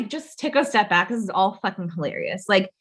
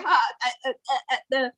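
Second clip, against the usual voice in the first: mock stutter; 5 semitones higher than usual. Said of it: "he starts to stutter".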